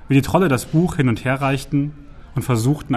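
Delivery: ordinary delivery, nothing marked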